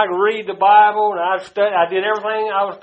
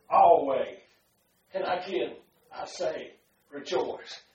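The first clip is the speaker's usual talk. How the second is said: quiet voice of God; 3 semitones lower, 8 dB quieter than usual. Retold or imitated. God answers him.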